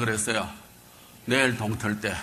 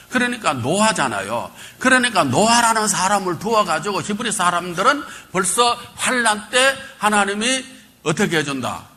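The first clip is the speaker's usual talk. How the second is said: preaching; 7 semitones higher, 3 dB louder than usual. Acted. Preacher builds.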